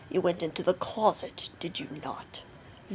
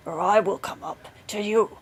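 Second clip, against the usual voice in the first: angry shouted command; 5 dB louder than usual. A narrator's reading. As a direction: shout up the stairs. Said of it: "cried Bluebeard".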